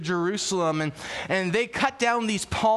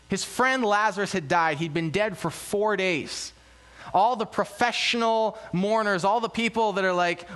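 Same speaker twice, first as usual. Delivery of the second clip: ordinary delivery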